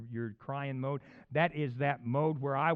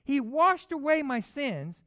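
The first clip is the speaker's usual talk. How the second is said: high, loud, stretched-out phrases; rising preacher's proclamation; emphatic scripture preaching; 9 semitones higher, 7 dB louder, slower than usual. Real, not acted. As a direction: proclaim the verse slowly, phrase by phrase. Reads scripture at full preaching force.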